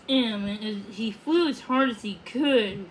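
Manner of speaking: ordinary delivery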